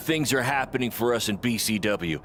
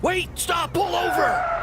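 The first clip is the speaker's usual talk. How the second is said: urgent shout; slower than usual. Acted. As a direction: shout it like he's about to miss something. Urgently yelling at the driver.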